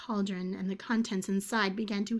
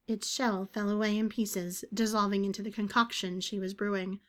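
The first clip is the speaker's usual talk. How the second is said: plain reading voice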